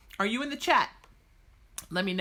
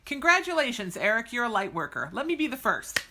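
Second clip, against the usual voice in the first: ordinary talk, nothing marked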